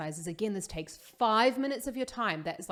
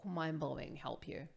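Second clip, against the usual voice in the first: near-whisper, much softer; hushed aside; 4 semitones lower, 9 dB quieter than usual. Real, not acted. Promising viewers the free lesson helps, hushed aside. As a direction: drop to an awed half-whisper.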